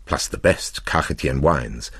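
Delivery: plain reading voice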